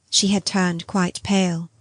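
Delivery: ordinary delivery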